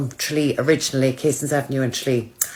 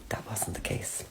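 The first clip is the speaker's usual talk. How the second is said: breathy comic whisper, dropped right down; whispered aside; 6 semitones lower, 17 dB quieter than usual. Acted. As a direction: whisper it like a secret confession. Comic aside after hydro class.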